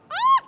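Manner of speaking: sharp high loud ah; sudden high shriek of alarm; startled cry. Real, not acted. Baby nearly rolls away.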